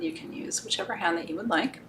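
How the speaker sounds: breathy whispered aside; whispering. Real, not acted. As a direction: whisper it close and gently. Soft aside during ritual.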